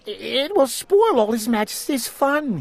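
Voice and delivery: stammering. A narrator's reading. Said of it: "he stammered".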